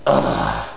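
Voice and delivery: low, creaky throaty sigh; sighing ugh. Shown as a demonstration. Coach showing the sigh sound everybody recommends, low throaty sigh. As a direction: let out a very low rattling groan. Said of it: "Do a sigh, the ugh sound".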